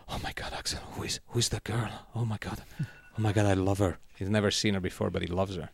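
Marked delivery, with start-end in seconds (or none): 0.0-4.0 breathless, hushed, smitten; imitating Nacho smitten. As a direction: gush in a breathy rush. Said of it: "goes like".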